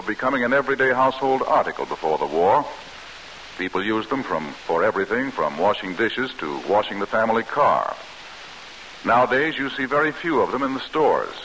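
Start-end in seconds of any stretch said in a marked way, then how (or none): none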